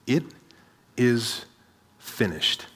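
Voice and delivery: low and hushed, a pause after each word; quiet, grave final declaration; solemn last words. Acted. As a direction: let each word fall softly and separately. Jesus's last words, solemnly quoted.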